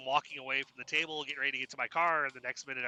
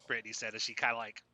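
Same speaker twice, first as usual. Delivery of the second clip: usual speaking voice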